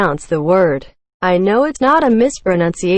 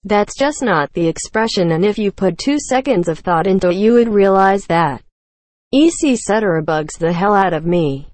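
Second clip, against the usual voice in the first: ordinary talk, nothing marked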